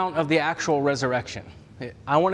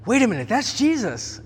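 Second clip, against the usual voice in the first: sudden realization; 7 semitones higher than usual. Retold or imitated. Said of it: "all of a sudden they're like".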